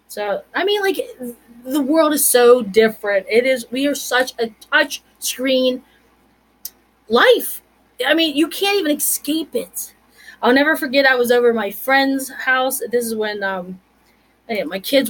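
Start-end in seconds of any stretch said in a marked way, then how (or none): none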